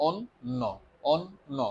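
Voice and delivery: অন ন split apart so the ন is heard twice; slowly syllabled. Shown as a demonstration. Teacher sounding out the double n for students, slowly. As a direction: break it into অন and ন, slowly. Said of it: "এটাকে আমরা slowly উচ্চারণ করি".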